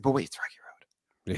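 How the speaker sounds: whispered, secretive aside; mock whisper. Fantasy manager whispering about Rocky Road start. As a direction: hush it like a guilty secret.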